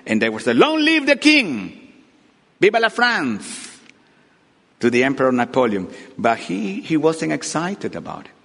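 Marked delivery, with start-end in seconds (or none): 0.6-3.8 high, drawn-out acclaiming shout; crowd cheering. Acted. Crowd shouting in Napoleon's favor, high cheering. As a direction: cry it out high for the king. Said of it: "shouting in his favor".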